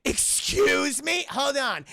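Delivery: high, loud, stretched disbelief; mock indignation. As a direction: act out an affronted teacher. Acted teacher's outraged reaction.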